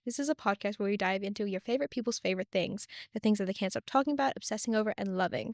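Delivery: polished, upbeat podcast host intro; scripted host welcome with lively emphasis; customer service voice. Acted. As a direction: greet listeners in your customer service voice, building to the guest's name. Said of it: "It's my customer service voice".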